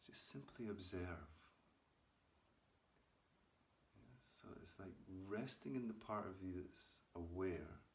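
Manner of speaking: calm guided meditation read to listeners. Acted. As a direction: guide them gently into watching the breath. Leads breath meditation for viewers.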